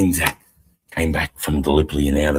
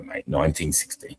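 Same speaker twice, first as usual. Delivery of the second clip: ordinary talk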